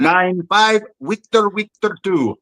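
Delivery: high, slow mock Russian accent; bad Russian accent. Host quoting the young officer's code entry, bad Russian accent. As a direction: put on Chekov's exaggerated Russian accent. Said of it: "He has a great bad accent".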